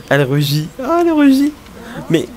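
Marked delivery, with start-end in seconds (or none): 0.8-1.5 high-pitched delighted squeal; gushing squeal. Real, not acted. Player gushing as the game character blushes, delighted squeal.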